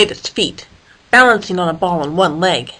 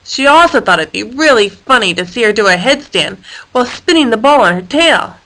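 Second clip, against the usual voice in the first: plain reading voice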